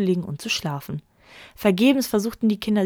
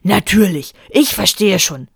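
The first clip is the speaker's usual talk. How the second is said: louder, slower sulking anger; wrathful; 6 dB louder than usual. A narrator's reading. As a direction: spit out the sarcasm. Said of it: "zürnte der Vater". (zürnend)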